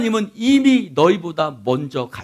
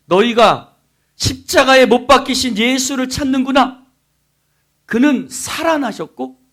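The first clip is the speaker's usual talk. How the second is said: loud, raised proclamation, lightly voiced angel; angel's announcement; 9 semitones higher, 9 dB louder than usual. Angel to the women seeking the body, proclaiming. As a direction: proclaim it like a messenger with news. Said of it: "흰옷 입은 천사가 이렇게 얘기해요".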